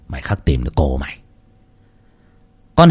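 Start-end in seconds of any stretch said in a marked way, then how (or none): 0.1-1.2 low, much softer sly mutter. Acted. Nam muttering slyly to himself as he walks off, low. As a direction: mutter it under your breath. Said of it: "mồm lẩm bẩm".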